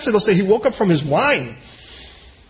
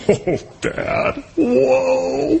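startled son; 4 semitones higher, much slower than usual. Retold or imitated. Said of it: "and he goes".

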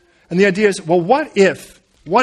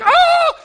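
high-pitched, panicked "Ah!"; startled yelp; 6 dB louder than usual. Acted. Joseph fleeing from the woman, startled yelp. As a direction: cry out as if suddenly ambushed.

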